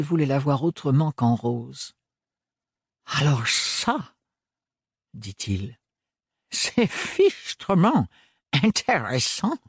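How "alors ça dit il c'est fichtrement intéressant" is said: fascinated eccentric. A narrator's reading. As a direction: relish every word, utterly intrigued. Lazarus fascinated, voiced high slow.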